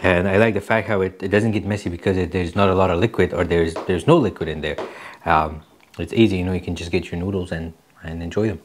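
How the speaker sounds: speaking between bites; talking while chewing. Real, not acted. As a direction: mouth partly full of noodles. Seen 2: Ate just before; lips pursed, noodles lifted near mouth throughout.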